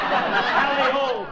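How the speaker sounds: loud, high, drawn-out cry; hammed-up call to the team; yell. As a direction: holler slowly to the sled dogs. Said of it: "I snapped the whip and yelled".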